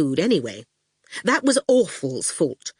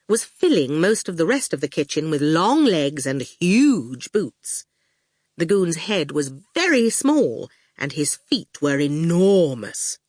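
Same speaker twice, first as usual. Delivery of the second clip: plain reading voice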